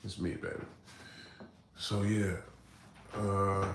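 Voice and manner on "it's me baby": put-on much softer "It's me, baby"; soft reassurance. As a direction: soothe her quietly. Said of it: "She's like".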